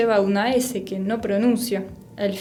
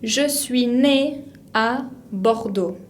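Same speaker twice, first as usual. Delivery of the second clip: slow, careful French read-aloud; measured teacher's French, phrase by phrase; model reading; 2 semitones higher, much slower than usual. Shown as a demonstration. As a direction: read it slowly for learners to repeat. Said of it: "podemos tratar de pronunciar juntos".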